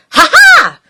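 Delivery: high, loud laugh. Acted. Grouch laughs at the monster's roar, high. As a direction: cackle in triumph. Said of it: "Grouch laughed".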